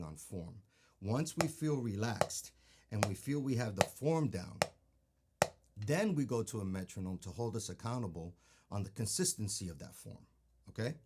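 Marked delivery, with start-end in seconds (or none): none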